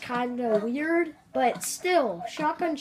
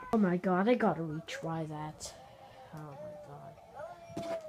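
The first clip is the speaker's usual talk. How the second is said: ordinary delivery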